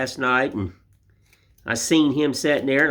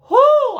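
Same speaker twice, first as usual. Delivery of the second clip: a high, loud worn-out whoo; tired whoo; 9 dB louder than usual. Acted. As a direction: blow it out like an exhausted Santa. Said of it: "He's like".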